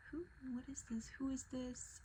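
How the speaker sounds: mild bewildered murmur; retold puzzlement. Retold waking to a strange tag.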